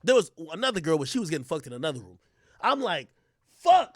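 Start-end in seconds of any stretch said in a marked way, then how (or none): 3.6-4.0 loud, higher, slow curse; mock-exasperated yell; frustrated shout. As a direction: bellow it in frustration. Realizing he's left out.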